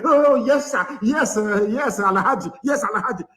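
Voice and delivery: high-pitched mock grovelling; mocking, obsequious underling voice; servile begging. Mimics servile begging to superiors.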